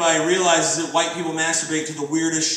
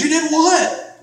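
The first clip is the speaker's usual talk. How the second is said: mock shock; 11 semitones higher, 4 dB louder, slower than usual. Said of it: "And he goes".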